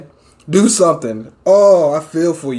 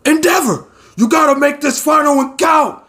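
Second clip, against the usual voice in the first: voicing Bakugo; 8 semitones higher than usual. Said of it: "He says".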